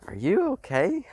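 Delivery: high pet voice. Real, not acted. Sudden worried call to someone nearby.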